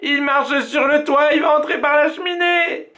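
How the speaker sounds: voix d'enfant très aiguë, affolée; fillette paniquée. A narrator's reading. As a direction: paniquer en montrant le toit. Violette panics about the roof. (Violette affolée, voix aiguë)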